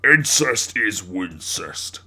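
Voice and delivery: slow, deliberate proclamation; Susan declaring. Acted. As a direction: declare it like a motto, drawn out. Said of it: "Susan declared".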